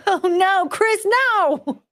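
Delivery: high, laughing "oh no"; laughing protest. Real, not acted. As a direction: squeal it while cracking up. Cracking up at Chris's joke.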